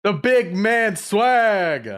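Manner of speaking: very slow, drawn-out swagger line; cringe hype line. Acted. Co-host fills in the show intro with a slow drawn-out brag. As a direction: stretch out the brag like a hype man. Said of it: "That was cringe".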